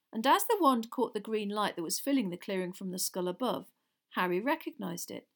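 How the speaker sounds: ordinary delivery, nothing marked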